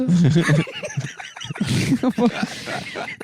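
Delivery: high breathy laugh breaking the bit; cracking up while still in the high accent; high laughter. Real, not acted. A friend laughing at his own mock accent.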